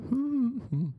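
short high hum; happy humming. Acted. Girl asks what he's doing, high humming reply. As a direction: answer with a high contented hum. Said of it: "I hum when I'm happy".

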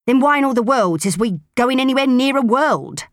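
exasperated gruff woman. Acted. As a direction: throw up your hands at it. Proser voiced, exasperated.

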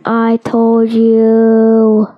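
loud, very slow I-told-you; drawn-out gloating. Real, not acted. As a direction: stretch each word out long and loud.

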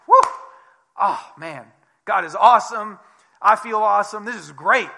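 loud, thrilled and triumphant; mock elated; elated relief. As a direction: crow with joy after the big win. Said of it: "Probably like".

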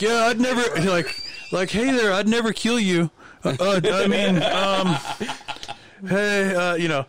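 high-pitched, flustered mock greeting; awkward fumbling. Imagining himself saying the wrong thing to Hulk Hogan, awkward fumbling. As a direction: stammer through an overeager, imagined hello.

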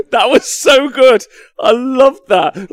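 high, loud and slow gushing; shouting with enthusiasm; excited shout. Real, not acted. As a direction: yell it with delight. Remembering leak fondly.

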